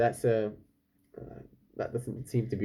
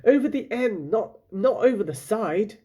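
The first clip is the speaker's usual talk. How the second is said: high, loud exasperated cry; 10 semitones higher, 5 dB louder than usual. Acted. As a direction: yell it at the clumsy fishermen. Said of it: "Lila cried".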